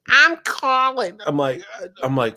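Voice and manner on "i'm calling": high, slow chirpy wife announcement; wife's voice. Retold or imitated. Husband quoting wife calling home, high voice. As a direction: announce it sweetly like his wife. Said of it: "she's like".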